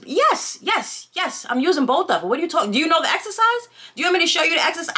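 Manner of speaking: high-pitched, rapid-fire indignation; indignant retort. Acted. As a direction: fire back in acted exasperation, speeding up. Acted indignant retort to the man.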